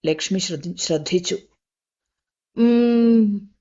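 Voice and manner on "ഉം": long hum. A narrator's reading. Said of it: "ഒരു വല്ലാത്ത മൂളൽ".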